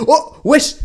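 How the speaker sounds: very high, loud surprised yelp; shocked exclamation. Real, not acted. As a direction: blurt out in shock. Caster shocked by a weird interaction.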